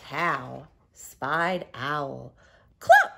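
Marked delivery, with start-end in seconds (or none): 2.8-3.1 very high, loud hen cluck; clucking cow. Acted. Teacher voicing Cow for her class, high loud clucking. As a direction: cluck like a chicken. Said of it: "said Cow".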